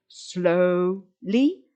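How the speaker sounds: "slowly" in two long, separate beats; two syllables. Shown as a demonstration. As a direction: sound out "slow-ly" syllable by syllable. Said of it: "begun with two syllables".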